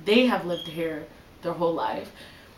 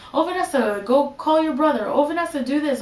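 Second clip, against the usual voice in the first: raised-pitch mock errand orders; higher voice, rattling off chores; imitated parent calling; 6 semitones higher than usual. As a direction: lightly mimic a parent summoning their child.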